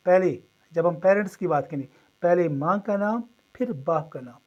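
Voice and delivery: ordinary talk, nothing marked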